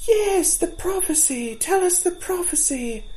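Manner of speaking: put-on children clamoring for the story; fawns crying out. A narrator's reading. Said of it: "The fawns took up his cry".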